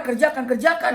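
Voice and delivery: high, loud urging; urgent command. Acted. A voice urging Ibrahim to obey, high and loud. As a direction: urge him on, loud and high.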